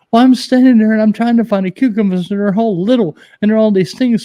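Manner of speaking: put-on high, loud girlish voice for the wife; imitating wife. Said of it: "She's like".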